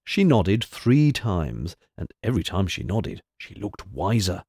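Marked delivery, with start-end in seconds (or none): none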